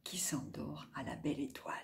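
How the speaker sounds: soft, fast whisper; hushed. A narrator's reading. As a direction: whisper him to sleep. Falls asleep, narration hushes.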